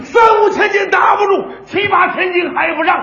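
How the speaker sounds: bang out the lines like a Shandong fast-talk ballad; punchy four-beat storytelling rhythm; Shandong kuaibao style. Acted. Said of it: "感情他会山东快书".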